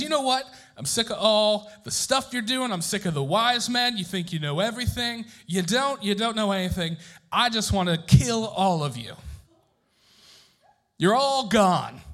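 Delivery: high, loud royal rage; furious king shouting. Acted. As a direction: roar it at the useless wise men. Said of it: "So the king gets furious".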